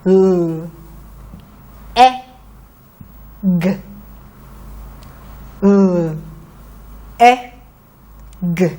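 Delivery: "L, E, G" each letter said apart with pauses; sounding out letters. Shown as a demonstration. Teacher sounds out letters for the children to blend. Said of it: "Let's try blending the sounds".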